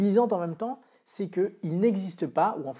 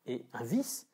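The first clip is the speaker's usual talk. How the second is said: ordinary talk, nothing marked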